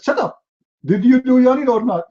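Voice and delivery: high-pitched mock angry interrogation; irate court interrogator. Sharia court judge grilling an accused yawner, mock angry shouting. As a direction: cut them off and demand an answer, pitched high. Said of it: "they say to you".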